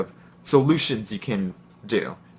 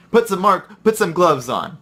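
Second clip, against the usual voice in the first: higher, louder nagging repeat; insistent repeated order; 7 semitones higher, 5 dB louder than usual. Retold or imitated. Coworker coming back to insist, mildly raised nag. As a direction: press the order on him again.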